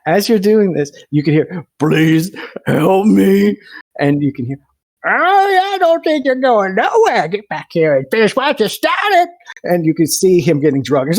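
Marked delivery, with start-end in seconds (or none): 1.8-3.6 slow, high, louder plea for help; a desperate cry for help; pleading for help. Acted. GM voices pleading victim. 5.1-9.3 much higher mocking captor voice; high, taunting villain drags his victim back.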